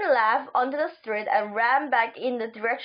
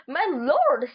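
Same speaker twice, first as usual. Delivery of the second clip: ordinary delivery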